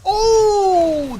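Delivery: loud, high caster exclamation; hype. Real, not acted. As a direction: react to the big read. Crowd moment after a big call.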